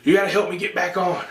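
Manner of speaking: high, urgent pleading dad voice; dad in the water calling up to his son, high and urgent; panicked dad. Retold or imitated. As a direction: beg to be pulled back up, pitched high. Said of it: "And he's like".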